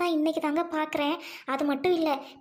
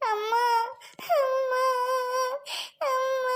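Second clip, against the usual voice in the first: put-on higher, tearful cry of a child; 5 semitones higher, much slower than usual. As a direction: sob out amma. Child crying. (அழும் குழந்தையின் அம்மா கூவல்)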